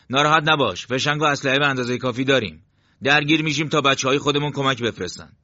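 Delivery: firm leader lifting his voice above the narration; reassuring squad leader. A narrator's reading.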